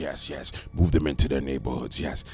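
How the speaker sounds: a low, sly insider voice; mock scheming insider. Mocking the party insiders behind the shield, low and sly.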